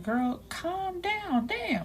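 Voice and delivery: high, drawn-out playful protest; mock exasperation. Real, not acted. Reader reacting to his gushing love speech, mock exasperated.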